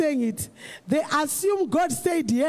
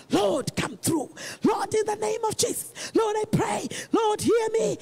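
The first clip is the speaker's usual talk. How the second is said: desperate praying shout; 4 semitones higher than usual. Acted. Praying person crying out to God.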